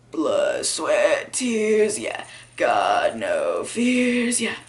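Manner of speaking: low, drawn-out chant as if just awake; mock morning voice. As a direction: drag the hook out down low. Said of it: "get back into your normal voice".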